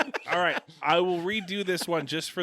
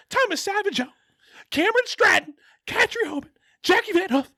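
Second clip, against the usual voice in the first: very high, loud caricature reading names; over-the-top squawky roll call; Harry Carey; 9 dB louder than usual. Acted. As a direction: shout each name in a strained, high character voice. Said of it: "read it as Harry Carey".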